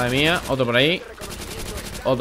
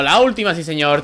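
ordinary talk

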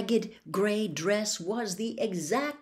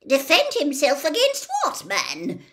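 indignant retort; 8 semitones higher, 6 dB louder than usual. Acted. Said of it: "said Ogden".

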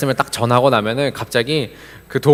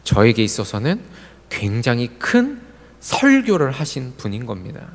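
ordinary talk, nothing marked

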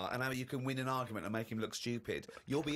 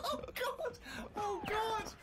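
high-pitched squeal of disbelief; aghast; much slower than usual. Real, not acted. [name] reacting to Steve's humiliation, a high aghast squeal. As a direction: gasp out Oh God in shock, up high.

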